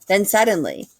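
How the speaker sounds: usual speaking voice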